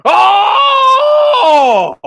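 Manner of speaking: high ringing hype cry; excited shout. Real, not acted. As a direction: let out a triumphant shout. Hype shout at own point.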